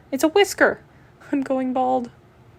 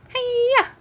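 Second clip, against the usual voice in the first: karate yell; 12 semitones higher than usual. Acted. Karate cat strikes again.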